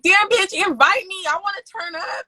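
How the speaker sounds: high, loud playful protest; hammed-up, exasperated plea; acted demand. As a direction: call out to a friend who left you out. Acts out demanding an invite from friends.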